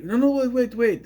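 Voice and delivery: high-pitched, urgent plea to wait; urgent call. Retold or imitated. Misha calls after the girl who runs away, urgent call. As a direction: imitate someone stopping a runaway.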